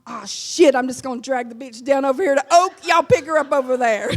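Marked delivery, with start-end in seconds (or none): none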